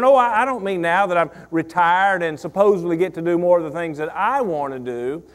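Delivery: normal talking voice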